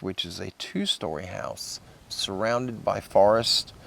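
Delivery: usual speaking voice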